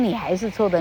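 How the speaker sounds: normal talking voice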